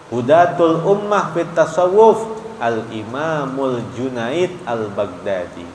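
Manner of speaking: ordinary delivery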